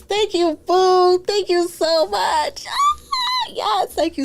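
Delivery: high, squealing thank you; overwhelmed with delight, almost crying; high excited thanks to a fan; gushing thanks. Real, not acted.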